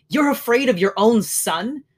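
high, loud scornful cry; indignant cry. A narrator's reading. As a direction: fling it at him. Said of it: "she cried".

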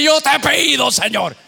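high, loud prayer over the noise; straining to be heard in the prayer; shouted prayer. Retold or imitated. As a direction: push your prayer above the shouting. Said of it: "Y yo quería decirte".

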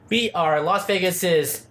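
ordinary talk, nothing marked

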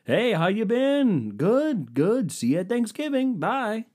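high, chirpy fake-friendly greeting; breezy brush-off with a bright high voice; mock awkward small talk. To wife's relatives at a wedding, a high fake-cheery chat.

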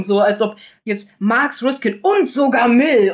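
escalating list ending on a mock-shocked Mill; mocking escalation. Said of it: "weil er sagt das so in so einer Steigerung".

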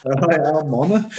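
hammed-up Shropshire accent. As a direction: put on a playful Shropshire local's hello. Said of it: "I'll have the Shropshire accent".

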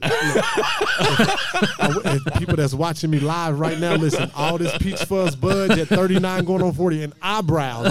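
high, laughing, drawn-out look; cracking up mid-sentence. Real, not acted. Laughing about his peach fuzz.

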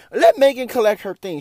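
loud, high exasperated outburst; heated outburst. Real, not acted. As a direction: let it burst out, exasperated. Heated rant about Grammys.

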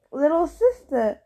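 called out loud and high. Acted. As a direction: hail her across the road, teasing. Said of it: "Apollo called".

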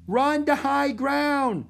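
high, slow shouted command; shouting. A narrator's reading. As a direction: urge the crowd away, stretching each word. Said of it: "shouted Jack".

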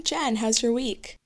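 normal talking voice

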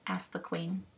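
plain reading voice

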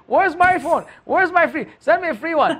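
high-pitched mock whining demands; high singsong mimicry of online beggars; demanding commenters. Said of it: "surely all the comments will be".

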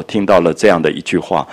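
ordinary talk

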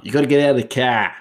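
put-on a shaky Boston Irish American accent that slides toward Irish. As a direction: bark it like a tough Boston local, high and loud at the start. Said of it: "That was a bit of a, that was a, um, not a very good one. That was more Irish than Irish American".

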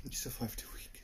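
breathy whispered repeat of the line; whispered echo. Acted. Friend echoes in whisper.

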